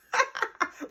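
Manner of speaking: breathy, high real laugh; airy laugh after the insult. Real, not acted.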